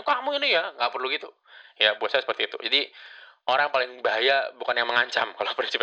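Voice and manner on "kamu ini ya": high, mock-angry scold at a worker; hammed-up angry boss. Shown as a demonstration. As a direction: play the boss losing his temper, half in jest. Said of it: "ngomongnya nggak usah marah-marah".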